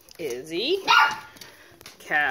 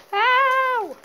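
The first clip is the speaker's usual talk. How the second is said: high sharp yelp of pain; 8 dB louder than usual. Real, not acted. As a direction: yelp as teeth catch. Puppy bites her toe.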